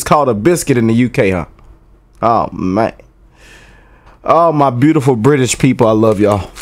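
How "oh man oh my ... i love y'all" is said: ordinary delivery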